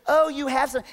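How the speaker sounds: high, light pointing out; note it brightly; mock wife. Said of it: "She goes".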